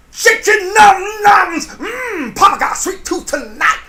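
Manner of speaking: high, loud, hammed-up silly voice; silly squeaky voice. Hammy voice teasing the viewers about juicy bums, high and loud. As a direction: squeal it out like a greedy cartoon papa. Playful silly voice after hips talk.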